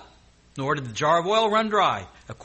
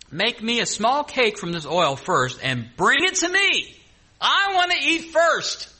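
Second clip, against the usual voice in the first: demanding prophet; 8 semitones higher, 5 dB louder, slower than usual. Acted. Preacher voicing Elijah's demand.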